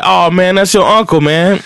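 high, amused imitation of the uncle; uncle's answer. Storyteller voicing his uncle's amused reply, pitched high. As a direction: toss it off with a laugh.

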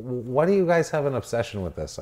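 high-pitched baffled disbelief; exasperated rising complaint. Real, not acted. Exasperated rant about dance scenes.

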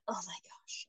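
breathy hushed whisper; whispered oh my gosh. Retold or imitated. Mom whispering her dread about the weigh-in. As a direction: whisper it in disbelief. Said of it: "And I was like".

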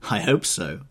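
usual speaking voice

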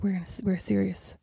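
low, slow, soft mock-serious reply; deadpan the boss's line quietly and slowly; understated stern imitation; imitated seriousness. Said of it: "Whereas they were obviously like".

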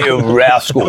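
laughing mock posh English voice; posh accent. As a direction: ham up a toff scolding. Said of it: "how was he saying it in the posh voice?".

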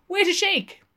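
high, loud plea; begging wait a shake. A narrator's reading. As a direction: plead for him to wait. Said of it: "begged Lenehan".

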